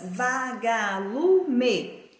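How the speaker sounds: "vagalume" stretched very slowly, each syllable drawn out; va-ga-lu-me, very slow teacher demonstration. Shown as a demonstration. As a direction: split "vagalume" into long, separate syllables. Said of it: "Se eu separar as sílabas".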